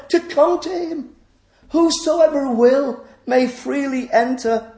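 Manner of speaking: high, sustained proclaiming cry; calling out. Acted. Voice on the verge of the broad way inviting all in, calling out. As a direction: ring it out across a distance. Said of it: "the voice calls out".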